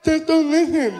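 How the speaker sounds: high imitation of a deaf friend; deaf man's voice. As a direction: coax in a high put-on deaf voice. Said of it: "He's like".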